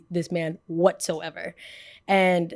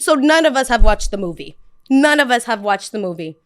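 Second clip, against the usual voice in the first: loud insistence; 3 semitones higher, 8 dB louder than usual. Retold or imitated. Insisting in argument.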